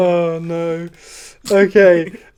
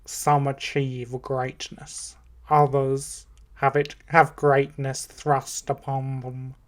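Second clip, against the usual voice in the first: slow, lower, soft drawn-out delivery; a hint of Rickman's languid menace, slowly; Alan Rickman; 3 semitones lower, 5 dB quieter, slower than usual. Game player reciting Shakespeare, lightly voiced slow Rickman. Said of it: "It's Alan Rickman!".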